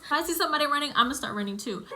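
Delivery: normal talking voice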